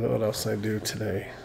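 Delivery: ordinary delivery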